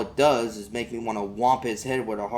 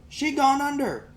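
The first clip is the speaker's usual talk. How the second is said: put-on high, urgent boy's voice; a kid telling his dad the boat sank; upset boy; 4 dB louder than usual. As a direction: blurt it like a panicked kid. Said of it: "I go".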